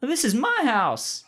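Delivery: put-on high, slow old Hollywood lilt; glamorous old Hollywood star; transatlantic accent. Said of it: "she has a transatlantic accent".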